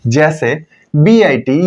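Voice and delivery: ordinary talk, nothing marked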